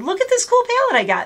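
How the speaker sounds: high, thrilled showing off; excited. Retold or imitated. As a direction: gush over a new palette. Quoting her excited self.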